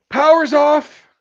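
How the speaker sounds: very high, very loud holler; full-voiced shouted announcement; shouting out. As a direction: call it up to someone overhead. Boss calls up to worker.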